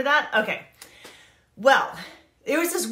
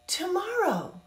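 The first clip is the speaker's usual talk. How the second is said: incredulous tomorrow; 8 semitones higher, 5 dB quieter than usual. Real, not acted. Incredulous rhetorical question.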